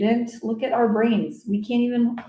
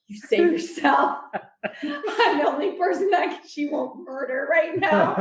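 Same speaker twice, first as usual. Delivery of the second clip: laughing quote; 8 semitones higher than usual. Retold or imitated. Brother bragging Nona won't murder him, laughing and pitched up. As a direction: giggle as you play the brother's boast. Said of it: "He's like".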